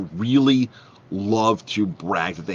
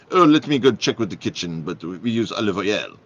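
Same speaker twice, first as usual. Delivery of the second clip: put-on French accent; 4 dB louder than usual. French waiter offers to check with the kitchen, French accent. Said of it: "So the waiter goes in this French accent".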